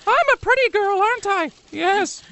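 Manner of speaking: put-on very high squeaky girly voice; falsetto girl voice. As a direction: coo like a fake pretty girl. Mocking a man faking a woman.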